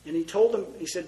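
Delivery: usual speaking voice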